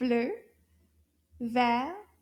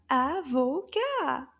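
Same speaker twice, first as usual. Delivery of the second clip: Avocat slowly divided into three beats; syllable by syllable; 3 dB louder, slower than usual. Shown as a demonstration. Teacher confirming three syllables, Avocat slowly split up. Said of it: "Trois syllabes".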